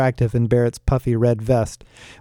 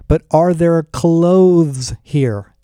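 loud, slow, higher-pitched demand; exasperated interruption, very drawn out; frustrated; 4 semitones higher, 5 dB louder, much slower than usual. A narrator's reading. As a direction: cut in, stretching the key word in exasperation. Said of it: "clearly frustrated".

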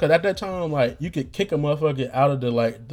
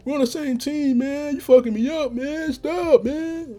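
very high, hammed-up whiny protest; mad brother, whining; 12 semitones higher, slower than usual. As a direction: whine like an upset kid brother begging you to quit. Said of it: "he'll get mad".

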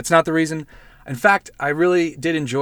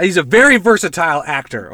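loud, higher mock-offended defence; mock indignation; 4 semitones higher, 8 dB louder than usual. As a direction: jump loudly to the actor's defence, jokingly.

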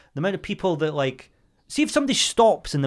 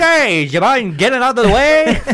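high, louder, mock-courtly ask; hammed-up polite; 9 semitones higher, 7 dB louder than usual. She tries a hammed-up politely phrased request to move, high and loud. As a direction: overplay the politeness.

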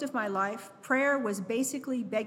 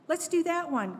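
ordinary delivery, nothing marked

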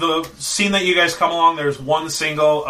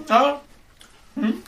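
put-on very old woman; 12 semitones higher than usual. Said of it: "this very old lady".

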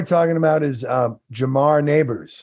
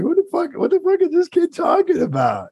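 much higher incredulous mock voice; baffled, gawking at the kid; hammed-up high disbelief; mock bewildered dad. Dad's bewildered reaction voiced.

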